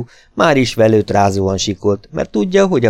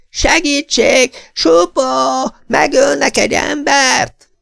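very high, slow, terrified shrieks; shrieking for help; 4 dB louder, slower than usual. A narrator's reading. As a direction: scream into the night in panic. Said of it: "és megint kivisít". (a nő ismét kivisít az ablakon)

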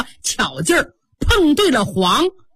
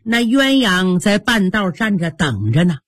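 plain reading voice

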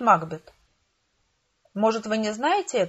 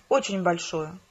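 ordinary delivery

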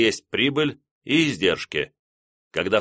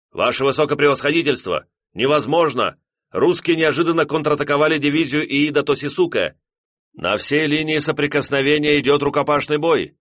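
raised, higher alarmed report; urgent shouted report; 6 semitones higher, 4 dB louder than usual. Acted. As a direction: blurt the bad news urgently. Officer reports disaster urgently.

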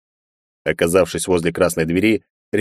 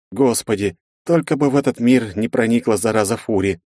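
usual speaking voice

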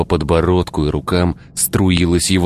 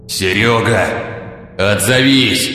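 growled call; 4 semitones higher, slower than usual. Acted. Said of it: "рычал прораб".

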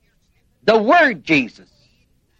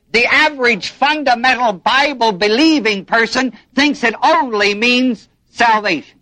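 ordinary talk

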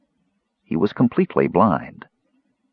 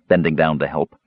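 usual speaking voice

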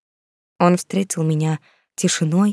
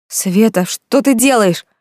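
desperate plea; 5 semitones higher, 4 dB louder, slower than usual. A narrator's reading. Trapped woman pleading.

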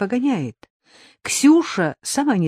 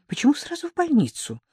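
high, quick alarmed protest; 9 semitones higher, faster than usual. Acted. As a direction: push back in alarm. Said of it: "воспротивилась Инна".

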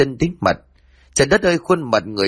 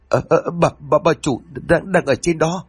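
frightened stammer; 6 semitones higher than usual. Acted. Terrified maid stammers to master.

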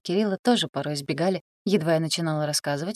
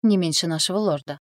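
ordinary delivery

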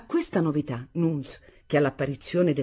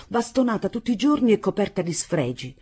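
ordinary delivery

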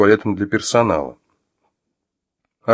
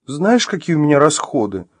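high, woeful complaint; tragic air; 8 semitones higher than usual. Acted. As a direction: lament it tragically. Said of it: "ответила Вероника с трагическим видом".

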